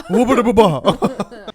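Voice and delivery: high, loud slow laughing gibberish; laughing scat. Real, not acted. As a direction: sing nonsense through laughter. Silly scat between laughs.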